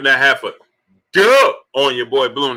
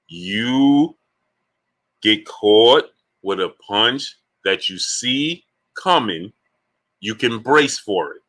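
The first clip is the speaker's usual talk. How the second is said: slowly, word by word with long pauses; slower; 4 semitones lower, slower than usual. Shown as a demonstration. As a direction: spell it out slowly for the slow listener. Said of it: "Let me do it slower".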